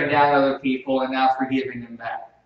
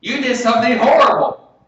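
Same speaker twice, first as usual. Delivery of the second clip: loud, high-pitched mock scolding yell; accusing yell; 10 semitones higher, 11 dB louder than usual. Preacher showing how not to confront a wrongdoer, accusing yell. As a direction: yell it at them as a wrong example. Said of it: "You don't need to yell at them".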